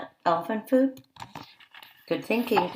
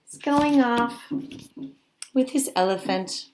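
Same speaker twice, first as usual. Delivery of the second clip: normal talking voice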